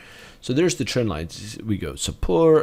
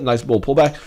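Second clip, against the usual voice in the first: ordinary talk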